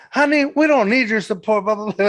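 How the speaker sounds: high-pitched sassy drag queen retort; going in on someone. Retold or imitated. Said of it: "going in on me".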